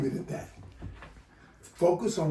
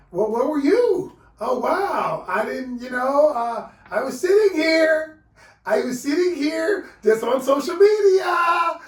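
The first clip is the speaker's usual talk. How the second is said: high, loud, whining and dodging; mock-whimpering, beating around the bush; whiny evasive partner; 10 semitones higher, 8 dB louder, slower than usual. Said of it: "They just like".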